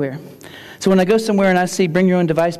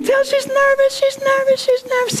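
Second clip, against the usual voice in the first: high mock nervous. Teacher mimicking nervousness, high.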